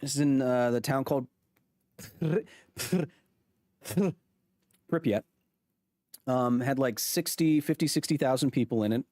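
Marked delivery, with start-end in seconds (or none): 2.0-4.2 "Pri-" broken off on a trilled R; struggling rolled R. Shown as a demonstration. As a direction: keep trying to trill the R in "Pri-". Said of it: "hard to roll the R".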